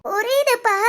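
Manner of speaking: tearful acceptance; crying. Acted. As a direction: agree through your tears. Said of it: "மொத அழாத, அழுகை நிப்பாட்டு". (அழுகையோடு சம்மதித்து)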